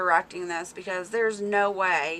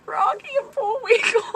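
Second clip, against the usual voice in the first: high, louder, breaking into laughter; real laughing; 14 semitones higher, 3 dB louder than usual. Real, not acted. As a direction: squeal the name while cracking up. Cracking up over a name.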